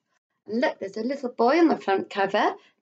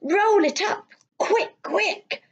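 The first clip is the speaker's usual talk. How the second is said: urgent mother; 11 semitones higher, slower than usual. Acted. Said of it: "his mother said".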